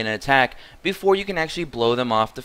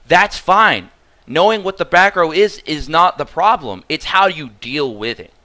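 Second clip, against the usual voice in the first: heated rebuttal; 5 semitones higher, 5 dB louder than usual. Real, not acted.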